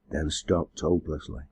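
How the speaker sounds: usual speaking voice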